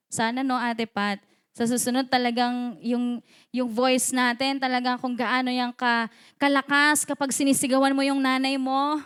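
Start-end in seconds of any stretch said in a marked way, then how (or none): none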